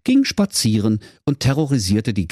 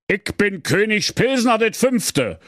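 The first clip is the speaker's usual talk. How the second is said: self-important king; 13 semitones higher than usual. A narrator's reading. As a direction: announce your title grandly in Berlin dialect. King announces himself to commissioner.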